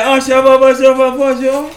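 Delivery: high, singsong mock-French chatter; sweet-voiced Frenchman. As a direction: prattle sweetly in mock French, pitched well up. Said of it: "got the sweetest voices".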